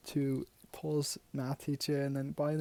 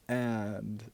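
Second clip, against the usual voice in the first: normal talking voice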